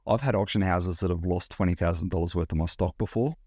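usual speaking voice

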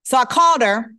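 ordinary talk, nothing marked

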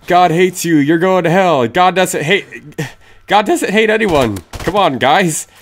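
usual speaking voice

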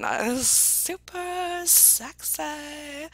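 slowly savored compliment; playful sultry admiration. Real, not acted. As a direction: draw out every word appreciatively.

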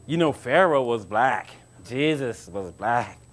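mocking believer. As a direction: drawl it out like a gullible follower. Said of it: "believers ... mentalities of boys and girls".

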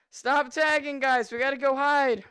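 loud call to stop; rally the lobby loudly; shouting to players. Real, not acted. Telling the lobby to stop tagging, in a loud call.

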